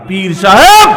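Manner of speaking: loud call rising at the end; shouted slogan. Real, not acted. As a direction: shout the name out for the crowd. Calling slogan to the crowd. (জোরে স্লোগানের ডাক)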